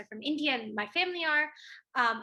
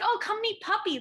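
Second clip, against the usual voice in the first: higher, louder playful call; playfully voiced, pitched-up invite; acted invitation; 9 semitones higher, 4 dB louder than usual. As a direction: call them over to meet your pet. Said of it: "I'll be like".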